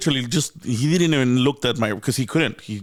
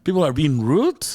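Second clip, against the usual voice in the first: indignant disbelief; 9 semitones higher than usual. Retold or imitated. Retelling his outrage at nurse's pay.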